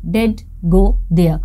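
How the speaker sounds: slow, stressed "did" in did go there; emphatic did. Shown as a demonstration. Teacher modelling emphasis for students, slow stressed did. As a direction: stretch did go there, leaning on did. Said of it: "वह वहाँ जाती तो थी".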